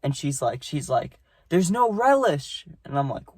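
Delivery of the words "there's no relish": high, slow mock old lady complaint; mimicking a cranky old customer. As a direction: squeak it like an irate old woman. Angry old lady voiced in story.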